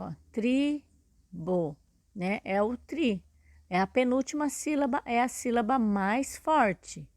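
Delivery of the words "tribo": "tribo" very slowly, split as tri, bo; syllable by syllable. Shown as a demonstration. Teacher finding the stressed syllable, "tribo" slowly split in two. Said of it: "qual é a sílaba tônica".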